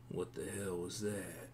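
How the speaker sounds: lightly voiced grump at the bar; surly bar patron. Stranger at the bar resents being tapped, quietly surly.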